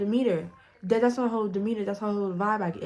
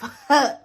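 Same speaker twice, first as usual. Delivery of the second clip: short, loud laugh; burst of laughter; 5 semitones higher, 8 dB louder than usual. Real, not acted. Narrator cracking up at her own wording, short loud laugh. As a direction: let out one sudden loud laugh.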